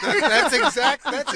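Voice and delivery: high, slow laughing words. Real, not acted.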